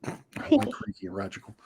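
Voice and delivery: soft unvoiced whisper. Real, not acted. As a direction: breathe it out as a whisper. Aside while reading chat.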